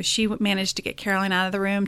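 ordinary delivery

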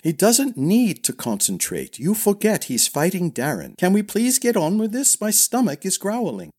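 high-pitched impatient retort; scoff, then complain impatiently; snorting scorn. A narrator's reading. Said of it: "Niles snorted".

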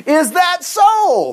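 high, drawn-out mock challenge; hammed-up royal disbelief, very slow and high; mock incredulous. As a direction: stretch it out loftily like a scornful king. Voicing Pharaoh's scornful reply.

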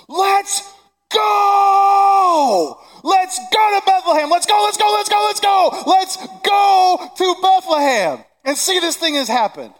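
put-on high, loud, shouting like a thrilled kid; excited shout. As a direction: yell it out like an eleven-year-old who can't wait. Shepherds as excited shouting kids.

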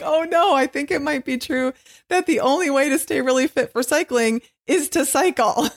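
laughing through the words; giggling while talking. Retold or imitated. Laughing at her own struggle.

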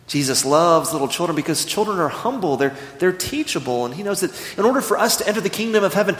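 usual speaking voice

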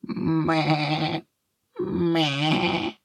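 high, thin bleating; high-pitched "meh" of a lamb; fine sheep bleat. A narrator's reading. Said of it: "ein feines Blöken ertönt".

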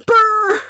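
loud, high comic wail; mock bawling. Woman hearing her verse prayed, exaggerated bawling. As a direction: burst into a long exaggerated sob. Acts out bursting into tears.

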